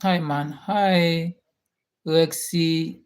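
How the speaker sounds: ordinary delivery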